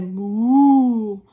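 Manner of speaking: long, very loud imitated moo; big drawn-out moo; cow's moo. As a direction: moo like a storybook cow. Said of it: "Cow said".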